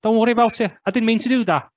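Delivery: high-pitched Scouse accent; Jamie Carragher. Shown as a demonstration. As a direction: drop straight into high Scouse. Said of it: "immediately just drops into, like, Jamie Carragher Scouse".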